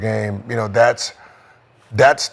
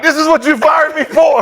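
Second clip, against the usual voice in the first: high, loud, incredulous shout; hammed-up indignant protest, pitched way up; Mike Brown, mock outrage; 8 dB louder than usual. Fired coach watching his replacement's interview, mock-outraged yell. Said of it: "I'm Mike Brown".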